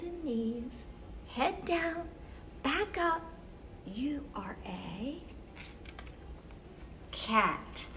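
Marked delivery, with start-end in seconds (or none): none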